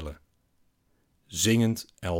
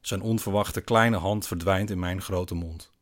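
plain reading voice

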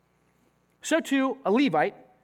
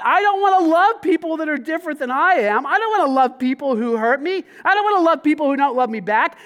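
louder, higher self-justifying complaint, imitating; selfish inner voice; 5 semitones higher, 6 dB louder than usual. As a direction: play the petty inner defense lawyer. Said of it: "He's saying inside".

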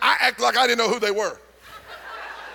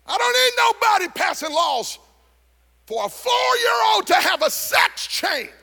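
high, loud, shouted preaching; preaching at full force; 13 semitones higher, 4 dB louder than usual. Real, not acted. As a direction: drive the congregation up to a fever pitch.

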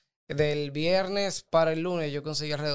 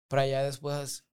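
ordinary talk, nothing marked

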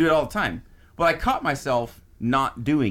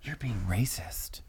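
whispered warning; 3 semitones lower, 10 dB quieter, slower than usual. Retold or imitated. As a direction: whisper it under your breath. Friends warn him quietly.

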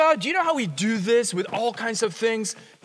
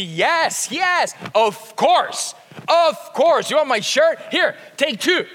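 high, loud, slowed eager "yes, of course"; cheerful eager yes; 6 semitones higher, 5 dB louder, slower than usual. Retold or imitated. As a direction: gush with generous delight. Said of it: "our response to be".

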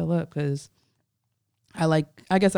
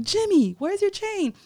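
high-pitched retold demand; urgent calling out. Performer calling to artist about missing chain, high urgent call.

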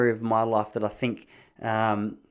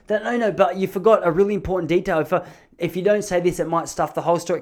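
higher-pitched urgent butting in; imitated insistent friend; 5 semitones higher than usual. Friend interrupts to fix a forgotten detail, insistent raised voice. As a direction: cut across the storyteller and press the point.

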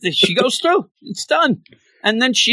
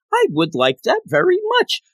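very high, sugary thank-you voice; gushing sweet Griever; 4 semitones higher than usual. Retold or imitated. Griever thanking the Fantastic Four, high giddy sugary gush. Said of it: "just to say".

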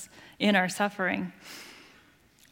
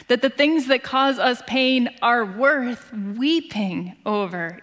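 usual speaking voice

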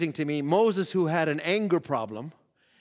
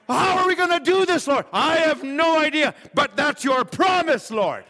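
higher and louder, declaring the promise; bold faith prayer; 9 semitones higher, 5 dB louder than usual. Pretend. Believer crying out to the Lord in bold faith. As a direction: shout your trust at the Lord.

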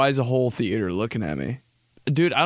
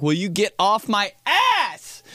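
higher, slow mock outburst; retold exasperation; 7 semitones higher, slower than usual. Comedian replies to a pushy sales pitch, mock exasperated. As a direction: drag it out, rising in mock annoyance.

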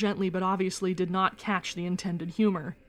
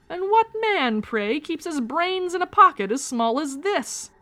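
much higher, louder, slow teasing jab; mock him grandly, slow and loud; mock-grand taunt; 8 semitones higher, 5 dB louder, slower than usual. A narrator's reading. Teasing attack on Lucas.

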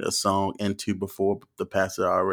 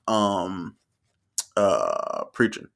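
normal talking voice

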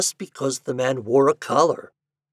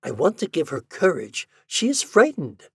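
high-pitched, earnest exclamations; exclaiming; 8 semitones higher, 3 dB louder than usual. A narrator's reading. Doctor describing a frightened patient to Ford, exclaiming. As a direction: plead her case in a raised pitch. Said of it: "he exclaimed".

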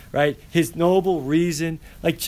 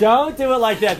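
louder laughing repeat; 7 semitones higher, 6 dB louder than usual. Real, not acted. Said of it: "repeated, louder".

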